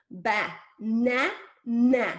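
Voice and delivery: BA... NA... NA, each NA loud and drawn out; exaggerated sounds. Shown as a demonstration. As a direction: separate and over-articulate BA, NA, NA. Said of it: "I'm exaggerating the sounds in my mouth".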